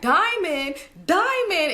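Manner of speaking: rising high sing-song "diamond"; mocking auntie. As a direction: taunt the word diamond, climbing. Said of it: "she was like".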